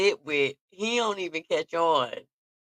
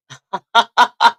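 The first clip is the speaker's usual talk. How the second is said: loud burst of laughter; 6 semitones higher, 12 dB louder than usual. Real, not acted. Laughs recalling aunt.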